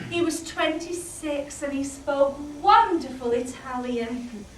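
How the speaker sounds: ordinary delivery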